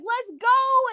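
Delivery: high-pitched reenacted cheer. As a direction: cheer like your side just won. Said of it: "I was cheering".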